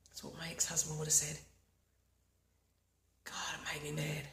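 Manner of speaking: quick whispered frustration. Acted. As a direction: hurry it out in a hushed whisper. Self-talk during tapping.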